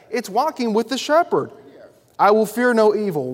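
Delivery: loud, ringing pulpit cadence, line after line; preaching at full force. Acted. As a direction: build the list like a revival preacher. Preacher lifts the Psalm passage.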